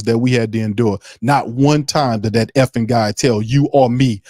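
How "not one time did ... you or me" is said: ordinary delivery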